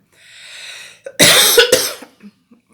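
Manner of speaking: harsh hacking cough; a real loud cough; coughing fit. Real, not acted. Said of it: "кашель у меня".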